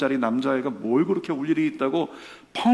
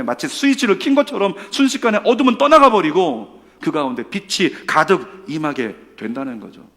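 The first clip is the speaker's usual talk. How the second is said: ordinary delivery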